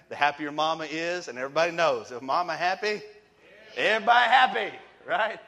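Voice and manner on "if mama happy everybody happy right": punchline shout. Acted. Preacher to the congregation, loud rising punchline. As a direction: jump up high and loud on everybody happy.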